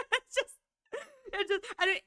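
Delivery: ordinary talk, nothing marked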